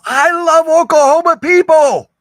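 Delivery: drawn-out, high, loud declaration of love; gushing delight. Real, not acted. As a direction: swoon over them out loud. Gushing after playing southern message.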